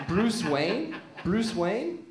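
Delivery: ordinary delivery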